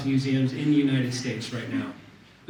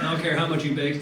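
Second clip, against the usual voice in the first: normal talking voice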